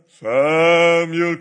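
loud, drawn-out divine call; booming voice of God. Retold or imitated. Preacher imitating God calling Samuel, booming. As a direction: call the name like a voice from heaven. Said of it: "what would Samuel's reaction be".

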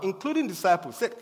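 usual speaking voice

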